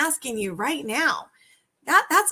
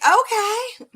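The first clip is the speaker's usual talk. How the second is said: high, flat, lazy okay with a lift; bland okay; 9 semitones higher, 5 dB louder than usual. Pretend. Coach mimics a seller answering a buyer, lazy okay. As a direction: brush off the eager buyer with one word. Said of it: "Would you say to me".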